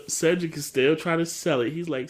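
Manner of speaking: usual speaking voice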